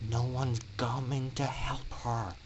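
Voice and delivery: soft, low seething through teeth. Acted. Orion seethes at watching students as nobody helps. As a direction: hold the anger in, barely above a breath. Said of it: "Orion seethed through closing teeth".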